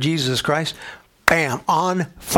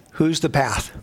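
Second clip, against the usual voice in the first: ordinary delivery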